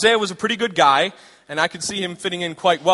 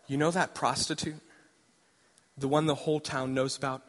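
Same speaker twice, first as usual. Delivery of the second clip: lower, softer loving divine voice; tender God; 3 semitones lower, 7 dB quieter than usual. Acted. God gently telling Hosea to marry the prostitute. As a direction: draw him in gently before the blow.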